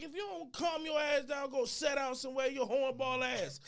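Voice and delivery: high, heated scolding; exasperated telling-off. Acted. Telling off a pushy guy in the club, exasperated.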